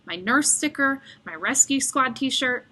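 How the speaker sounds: plain reading voice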